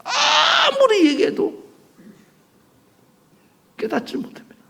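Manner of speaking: very high, slow drawn-out cry; preaching shout. Real, not acted. Preacher lamenting the disciples' blindness, shouting high and slow. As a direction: cry it out to the rafters.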